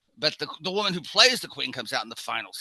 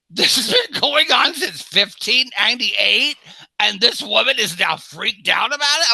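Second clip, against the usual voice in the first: high, loud, slow exasperated disbelief; loud, high, drawn-out indignation; incredulous; 9 semitones higher, 9 dB louder than usual. Retold or imitated. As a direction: scoff at her loudly, dragging it out. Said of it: "And I went".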